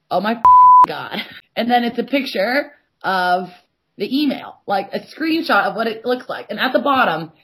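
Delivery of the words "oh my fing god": louder and slow, fed up; exasperated outburst. Retold or imitated. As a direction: drag it out in disbelief. Exasperated reaction to partner's text.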